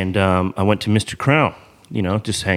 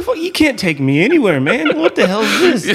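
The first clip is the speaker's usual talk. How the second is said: high, laughing mock outrage; laughing complaint; 12 semitones higher, 4 dB louder than usual. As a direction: laugh through the fake indignation. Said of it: "I'm like".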